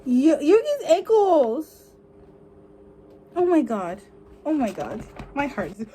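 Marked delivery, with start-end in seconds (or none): none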